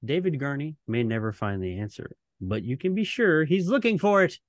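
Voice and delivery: dramatic TV host narration, rising on the questions; ominous announcer voice, playing it up; Serling closing narration. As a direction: read it like the closing of a creepy anthology show. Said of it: "do the closing narration".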